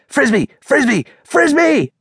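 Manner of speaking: rapid-fire, high-pitched glee; excited yelping. A narrator's reading. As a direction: yelp like an overexcited dog. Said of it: "she yelped".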